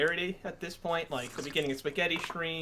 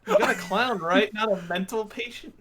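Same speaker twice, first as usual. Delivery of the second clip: put-on whiny kid; 5 semitones higher, 3 dB louder than usual. Mock child complaining about clown.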